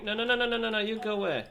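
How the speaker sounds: high loud rapid no no no; shooing yell. Real, not acted. Player shouting at escaping chicken, high and loud. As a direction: yell the chicken back in a panic.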